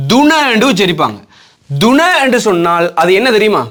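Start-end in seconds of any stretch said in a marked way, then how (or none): 0.1-0.5 துண said with a voiced d at the start, as in "duna"; voiced-d slip on துண; common mispronunciation. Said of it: "நிறைய பேர் இதை உச்சரிக்கிற முறை".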